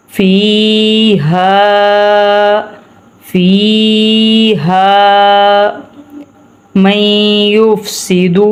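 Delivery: فيها with long ii and long a; lengthened fi ha. Shown as a demonstration. Teacher shows madd on فيها, stretched vowels. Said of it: "اسے لمبا کرتے ہیں".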